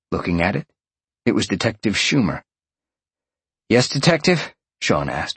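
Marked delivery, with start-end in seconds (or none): 3.7-4.5 higher, tentative questioning lift; a little nervous. A narrator's reading. Sean answering the detective's call, a little nervous. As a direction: answer the phone with mild nerves. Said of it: "a little nervously".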